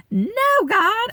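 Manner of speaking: high, loud mock-outraged refusal; indignant protest. Wife balking at the verse about submitting to husbands, indignant. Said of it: "I was mad".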